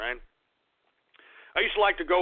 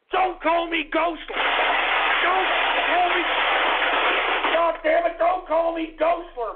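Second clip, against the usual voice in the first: furious shout; 9 semitones higher than usual. Acted. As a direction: yell down the hecklers. Raging at name-calling.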